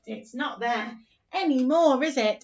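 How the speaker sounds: usual speaking voice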